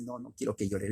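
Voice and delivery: the mother caving in softly; a hushed, indulgent mother; soft mock mother. Mother voiced in story.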